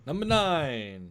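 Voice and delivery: slow booming countdown announcer. Shown as a demonstration. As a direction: draw it out like a TV announcer. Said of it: "Say it like an announcer".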